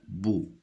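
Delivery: bou, blended slowly from B and O; détache la syllabe bou; sounding out the syllable. Shown as a demonstration. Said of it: "B avec O". (syllabe bou articulée)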